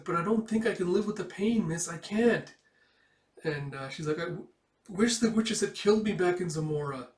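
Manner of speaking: put-on high, loud, anguished girl's voice; despairing girl. Girl blaming herself for the deaths, high anguished voice. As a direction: pour out the guilt, close to tears. Girl saying she can't bear pain.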